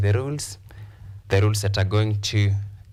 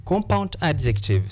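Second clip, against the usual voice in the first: ordinary talk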